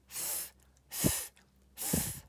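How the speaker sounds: "Th" as voiceless air through the teeth; "th" alone, three soft puffs without a vowel; digraph sound. Shown as a demonstration. Teacher drills digraphs with pupils, isolated "th" sounds. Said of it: "Let's make these sounds three times each".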